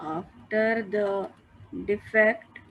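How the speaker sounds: slow, measured dictation to students; dictating notes. Acted. Teacher dictating notes to class, slow and measured. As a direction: spell it out slowly so they can write it down.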